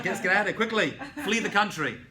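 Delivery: hammed-up urgent warning; mock panic. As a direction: play at urging everyone to flee. Acting panicked listeners hearing German spoken.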